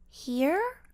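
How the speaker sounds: high, questioning rise in pitch; confused, high-pitched query; confused exclamation. A narrator's reading. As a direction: blurt it as a puzzled question. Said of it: "her voice filled with confusion".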